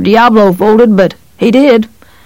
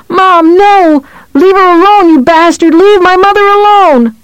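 put-on high, loud, frantic boy's screams; desperate screaming; 13 semitones higher, 4 dB louder than usual. A narrator's reading. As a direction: shriek it in panic. Boy screams as mother taken.

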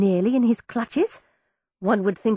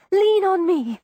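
higher, louder urgent plea; 7 semitones higher, 4 dB louder than usual. A narrator's reading. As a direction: press him to hurry. Urging wounded brother to flee.

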